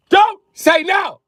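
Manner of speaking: high, loud, slow-punched yell; drawn-out high outburst; exasperated shout. Acted. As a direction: scream it in mock fury. Shouted repeat to daughters.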